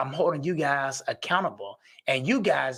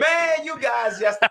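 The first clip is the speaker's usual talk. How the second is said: high, drawn-out excited outburst; real excitement, pitched well up; 9 semitones higher, 4 dB louder, slower than usual. Real, not acted. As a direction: stretch it out, carried away with excitement. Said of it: "'cause I'm getting excited".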